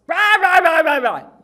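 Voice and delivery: high, shouted nonsense tirade; husband's angry rant. Retold or imitated. Husband picking a fight with his wife, imitated shouting. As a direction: bellow mock-furious babble, tailing downward. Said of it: "he would kinda do that".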